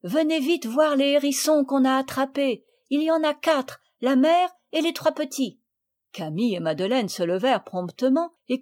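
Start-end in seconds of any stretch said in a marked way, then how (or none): 0.0-5.6 high, eager call to come quick; excited call. Acted. Marguerite urging the girls to come see. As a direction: hurry them over, thrilled. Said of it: "leur cria-t-elle".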